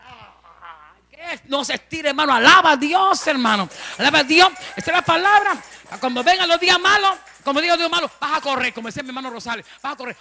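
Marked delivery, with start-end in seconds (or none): 2.3-8.7 loud fervent pulpit delivery; full-force preaching. Real, not acted. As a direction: preach it out to the congregation. Predicación a toda voz. (predicación a todo pulmón)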